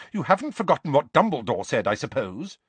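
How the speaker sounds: high stern motherly voice; stern Mrs Weasley. A narrator's reading. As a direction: lay down the law like a mother.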